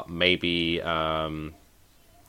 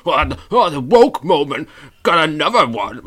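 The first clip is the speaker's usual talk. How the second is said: high, loud blustering complainer voice; put-on outraged whining, loud and high; mock outrage; 8 semitones higher, 8 dB louder than usual. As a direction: ham up an indignant culture-war rant. Mocking people outraged over cartoon.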